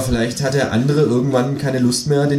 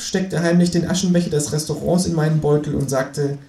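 ordinary delivery

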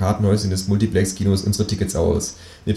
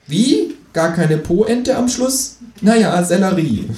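high, slow, surprised kangaroo; high-pitched talking animal; 11 semitones higher, 3 dB louder, slower than usual. Acted. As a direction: question it in a drawn-out high creature voice. Kangaroo comments on footnote.

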